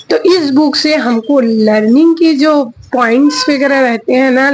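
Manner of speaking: normal talking voice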